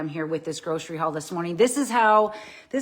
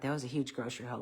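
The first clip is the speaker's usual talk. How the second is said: out of breath; 7 semitones lower, 10 dB quieter, faster than usual. Real, not acted. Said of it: "I gotta catch my breath".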